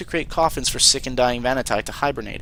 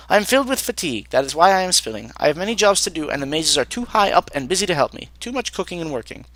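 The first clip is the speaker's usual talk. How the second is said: higher-pitched overworked cook, complaining; tired, harried cook; 4 semitones higher than usual. Acted. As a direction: complain like a put-upon servant. Voices overworked, clumsy cook.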